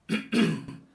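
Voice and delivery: a short raspy throat-clearing cough; throat cough demo. Shown as a demonstration. Teacher demonstrates the throat-bass cough sound. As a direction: rasp the throat to show the buzz. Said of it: "อย่างนี้ครับ".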